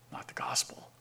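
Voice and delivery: whispered, almost unvoiced; hushed punchline whisper. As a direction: breathe it like a secret. Preacher drops to a whisper.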